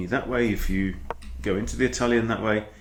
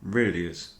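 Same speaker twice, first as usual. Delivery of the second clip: ordinary talk, nothing marked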